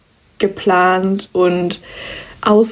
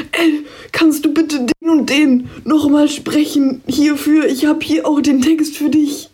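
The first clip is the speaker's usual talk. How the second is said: high-pitched imitation of a panicky plea; panicked voice message; 6 semitones higher than usual. Colleague sends last-minute voice message asking for a recording, mock panic. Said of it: "eine ganz gepanikte Sprachnachricht".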